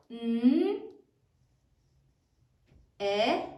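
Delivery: N, E, F as isolated sounds with long gaps; sounded out while writing. Shown as a demonstration. Teacher modelling spelling for pupils, N, E, F sound by sound. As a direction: say each sound, N, E, F, at handwriting pace. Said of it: "as you write it".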